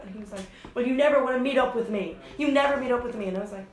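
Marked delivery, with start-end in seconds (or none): 0.7-3.3 louder, higher imitation of a whining complaint; mock accusing. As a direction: hit "never" like an aggrieved accuser. Acting out his angry accusation.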